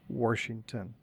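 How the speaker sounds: WASHINGTON said as Warshington; intrusive R. Retold or imitated. Quoting mother's pronunciation, Washington with an added R. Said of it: "she also pronounced it".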